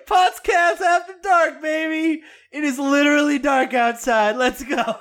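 rally everyone like a late-night party host; mock hype. Hyping the late-night show.

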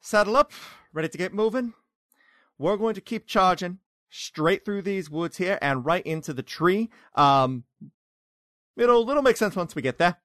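lively, upbeat guide; cheery guide voice. Acted. GM voicing Jack, cheerful.

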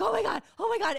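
stunned movie-theater oh my God; excited gasp. Retold or imitated. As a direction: gush it like the screen just blew you away. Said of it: "I'm like".

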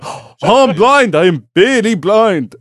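high mock Japanese-accented wail; hammed-up cry in a fake Japanese accent.

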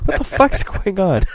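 high, loud, baffled disbelief; what on earth is happening?. Retold or imitated. Baffled onlooker hearing grandpa's gibberish, high loud disbelief.

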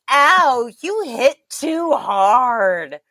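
high, slow hurt robot complaint; mock-injured robot; whiny robot voice. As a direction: whine it like a hit machine. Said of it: "the other one goes".

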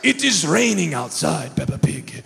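hammed-up cartoon pig dad; Daddy Pig. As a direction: rumble it like a jolly cartoon father. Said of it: "here comes Daddy Pig".

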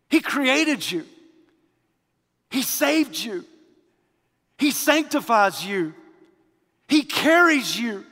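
loud, high, ringing sermon voice; preaching at full force. Acted. Preacher exhorting the Christmas congregation, loud and high. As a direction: proclaim it to the whole church.

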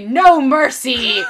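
loud, stretched-out battle cry; mock-heroic war shout; G I Joe. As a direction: shout it like an action-figure soldier. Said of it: "the G I Joes would say".

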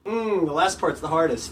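usual speaking voice

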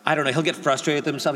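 ordinary delivery

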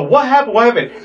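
high-pitched mimicked panic; mock alarm. Housemates see Chandler running out, mock alarmed questioning. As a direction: call it out high, imitating alarmed onlookers. Housemates react to fleeing roommate.

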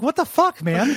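high, loud, disbelieving; incredulous. Retold or imitated. Player reacting to the game's plot twist, mock incredulous. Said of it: "I'm like".

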